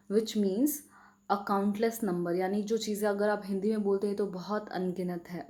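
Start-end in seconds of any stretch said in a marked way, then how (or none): none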